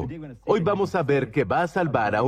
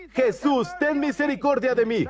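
súplica aguda, a modo de clamor; grito imitado; 8 semitones higher than usual. Retold or imitated. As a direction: imita a quien clama pidiendo misericordia. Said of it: "Él fue un hombre que gritó". (imitando un grito suplicante)

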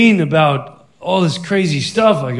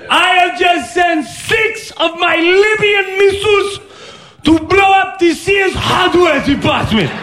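mock Muammar Gaddafi; 13 semitones higher than usual. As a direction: bellow a hammy dictator ultimatum down the phone. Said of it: "This is the great Muammar Gaddafi".

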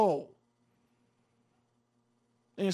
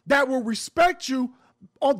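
ordinary talk, nothing marked

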